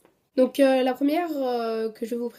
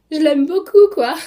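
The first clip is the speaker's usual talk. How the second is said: high, loud exaggerated gush; mock gushing; 6 semitones higher, 11 dB louder than usual. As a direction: overdo the love for comic effect. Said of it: "Non, plus sérieusement".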